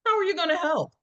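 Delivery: high, exasperated mother's scold; a mother scolds her son for not washing, exasperated. Acted. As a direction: snap at the boy in disbelief.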